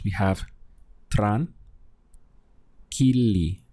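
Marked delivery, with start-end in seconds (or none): 1.1-3.6 tran and kili split apart, each said on its own; syllable by syllable. Shown as a demonstration. Teacher splits the word for learners, syllable by syllable. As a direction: break tranquilidad into tran, kili. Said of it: "Let's go through the syllables".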